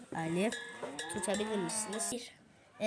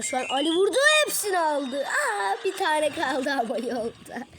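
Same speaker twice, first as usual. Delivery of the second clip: screaming football commentator; 6 semitones higher, 9 dB louder than usual. Acted. Commentating teammate's scoring play.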